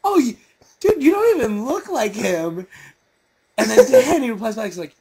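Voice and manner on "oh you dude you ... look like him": flustered cover-up. Retold or imitated. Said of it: "to cover up, he's like".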